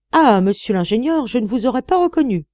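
high, loud exclamation of recognition; surprised recognition. A narrator's reading. Former miner failing to recognize the engineer, surprised. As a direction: exclaim as you suddenly recognize someone.

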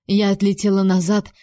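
usual speaking voice

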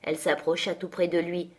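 plain reading voice

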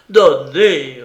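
sharp loud cry of one word; desperate cry. Acted. Aratov repeating his plea to Anna, loud desperate cry. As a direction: hurl the word out in despair. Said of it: "répétait-il".

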